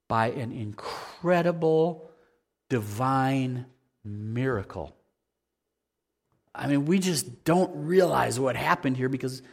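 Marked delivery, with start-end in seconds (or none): 0.1-4.9 slow, soft and awed; softly marvelling at the miracle; hushed awe. Acted. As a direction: let the wonder sink in slowly.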